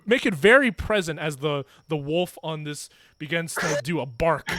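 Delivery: usual speaking voice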